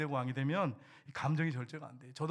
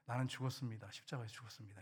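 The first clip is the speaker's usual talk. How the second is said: quiet proclamation; 6 semitones lower, 9 dB quieter than usual. Shown as a demonstration. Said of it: "이렇게 선포해 보세요".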